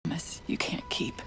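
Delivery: slow breathy whisper to herself. Acted. Cortana to herself about the Chief's promise, whispering. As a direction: whisper it so he won't hear.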